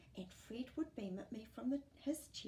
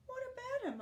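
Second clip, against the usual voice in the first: put-on much higher worried boy; alarmed boy. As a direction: ask with sudden fear.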